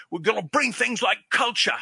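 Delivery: put-on high, loud British gangster accent; Bob Hoskins. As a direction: declaim it in a put-on London accent. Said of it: "You hear Bob Hoskins talk".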